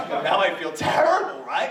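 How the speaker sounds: laughing at himself as he says it; rueful laugh. Real, not acted. Admitting to the congregation he misjudged the pastor, laughing sheepishly. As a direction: chuckle through the guilt. Laughs at his own mistake.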